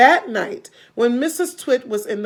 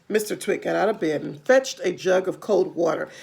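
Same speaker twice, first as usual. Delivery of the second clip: usual speaking voice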